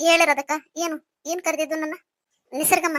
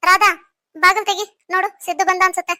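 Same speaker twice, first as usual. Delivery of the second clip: calling out; 4 semitones higher, 7 dB louder than usual. Acted. Calling maid across house.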